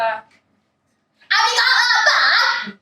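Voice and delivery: high, slow, stretched-out call; shouted question. Acted. Hyper mother shouts across the ward to a patient. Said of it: "she shouted out".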